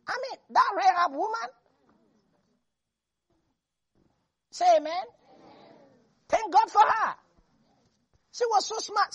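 high, excited preaching praise; fervent praise. Real, not acted. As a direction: lift it up in joyful praise. Preacher praising Rahab, rising fervor.

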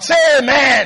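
calling for amen. Real, not acted. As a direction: demand a real answer from the room. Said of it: "Please use your mouth".

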